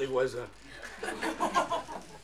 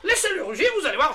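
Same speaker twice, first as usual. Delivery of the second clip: laughing protest; 8 semitones higher than usual. Retold or imitated. Owner defends his lion to the neighbours, laughing. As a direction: argue back, giggling, up high. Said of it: "je leur dis".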